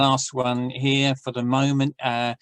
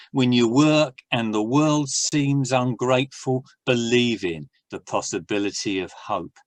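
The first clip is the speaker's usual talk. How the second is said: measured, earnest poem recital; poem reading; 2 semitones lower, 3 dB louder than usual. Acted. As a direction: read it as a hopeful poem. Reads his poem.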